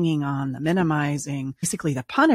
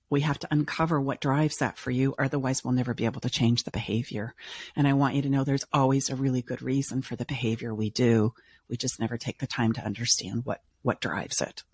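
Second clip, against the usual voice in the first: normal talking voice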